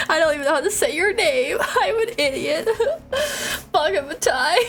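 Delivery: high giggling through the words; laughing at herself. Real, not acted. Player laughing at herself for getting his name wrong. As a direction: laugh it off, half crying. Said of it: "laughing at own mistake".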